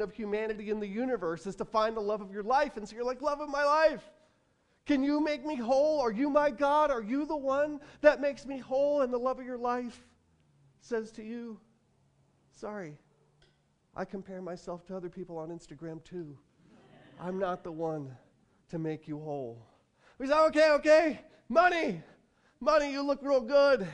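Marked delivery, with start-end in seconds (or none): 3.2-9.0 high, loud mock plea to a lover; over-eager romantic appeal, pitched way up; imploring. Acted plea to a soulmate. 12.5-19.6 soft, subdued reply after a pause; understated, deflating confession; apologetic letdown. Acted. 20.4-23.9 high, loud, hammed-up excitement; mock-enthusiastic wooing, pitched way up; eager. Acted turn to money as saviour.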